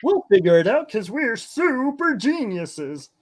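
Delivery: high-pitched, self-congratulating send-up; mock genius. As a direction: play a smug fool proud of the obvious. Mocks characters as supposed geniuses.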